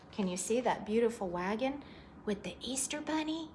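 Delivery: ordinary delivery, nothing marked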